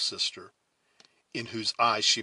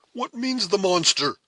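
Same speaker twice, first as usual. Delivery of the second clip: raised, loud, shocked question; astonished demand; 11 semitones higher, 9 dB louder than usual. A narrator's reading. Heyward challenging the captor's threat, astonished demand. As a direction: demand an answer, high and loud. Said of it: "demanded the astonished Heyward".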